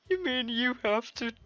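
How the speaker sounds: put-on a touch of pouty high girl voice; Natsuki's bratty voice. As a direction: snap back like an offended little girl.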